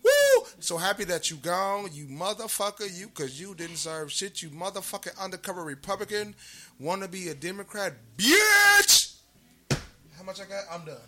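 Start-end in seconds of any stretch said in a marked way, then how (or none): 0.0-0.5 high, loud whoop of joy; celebratory whoop. Real, not acted. Cheering that the sheriff is gone, loud whoop. 8.2-9.1 high, loud yelled bitch; shouted insult. Real, not acted. Ending the rant at the sheriff with a shout.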